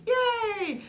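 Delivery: high, delighted squeal of triumph; joyful cry. A narrator's reading. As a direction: cheer like a thrilled little squirrel. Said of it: "cried Squirrel".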